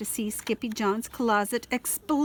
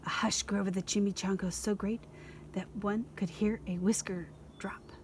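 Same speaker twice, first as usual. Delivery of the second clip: whispered; 6 dB quieter than usual. A narrator's reading. Hushed narration.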